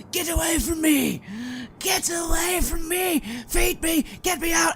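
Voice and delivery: high, loud desperate screams; agonised wailing. Acted. Chained creature repelling the cleric, agonised wail. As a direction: shriek it as if chained and burning. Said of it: "He wails in agony".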